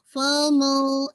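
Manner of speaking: फमो very long and loud through the nose; wrong nasal sound. Shown as a demonstration. Teacher shows what not to do, nasal recitation. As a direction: drag फमो out as an over-nasal hum. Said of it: "ऐसे करके nasal sound नहीं".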